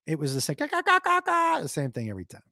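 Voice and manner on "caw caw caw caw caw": squawking goose call, a bit louder; high, louder goose call. Retold or imitated. Admits he can't vary his goose noise, high squawk. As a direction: try to vary the squawk but it comes out the same. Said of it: "I tried to mix it up".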